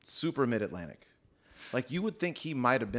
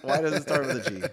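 laughing; 3 semitones higher, 6 dB louder than usual. Real, not acted. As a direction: laugh as you insist. Laughing while arguing spelling.

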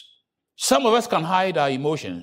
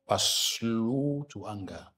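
slow, soft emphasis; 7 semitones lower, 9 dB quieter, much slower than usual. Shown as a demonstration. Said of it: "stressing scripture phrase".

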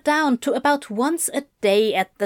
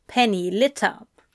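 ordinary delivery

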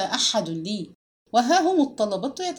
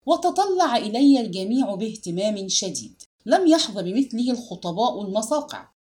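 ordinary delivery, nothing marked

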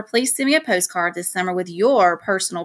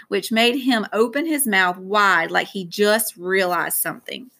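usual speaking voice

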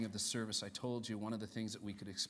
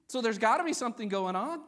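loud, high, muddled mock-Irish lilt; half-Irish accent; 12 semitones higher, 14 dB louder than usual. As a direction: exclaim it in a dubious Irish accent. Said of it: "imagining somebody with half an Irish accent".

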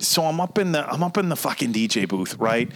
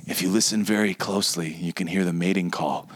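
hushed, softer mock wildlife narrator; mock nature documentary whisper; 8 semitones lower, 4 dB quieter than usual. DJ on the microphone mocking the bird-call guy, in a hushed nature-documentary whisper. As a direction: whisper it like you're stalking a rare bird.

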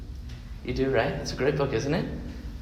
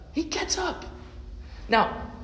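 much higher, hushed amazement; amazed; 13 semitones higher, 9 dB quieter than usual. Acted. Preacher marveling the dead boy rises, amazed. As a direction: marvel at the miracle. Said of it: "maybe I need to try again".

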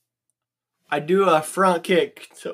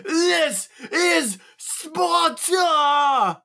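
very slow, high-pitched war cry; battle cry; 13 semitones higher, much slower than usual. Acted. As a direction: stretch each word into an over-the-top roar. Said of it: "I go say".

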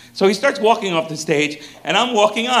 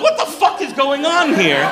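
bewildered; 9 semitones higher than usual. Retold or imitated. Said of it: "I'm like".

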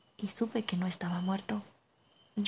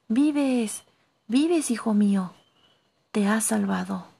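loud, raised cry of relief; joyful outcry; 7 dB louder, slower than usual. A narrator's reading. Uncle finds his lost nephew alive, loud relieved outcry.

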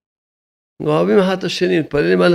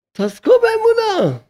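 high, slow exhortation; slower than usual. Acted. Rabbi urging listeners toward faith, high and slow.

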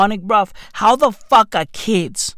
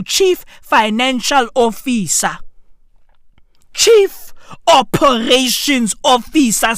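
ordinary talk, nothing marked

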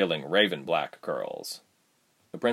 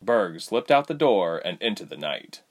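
usual speaking voice

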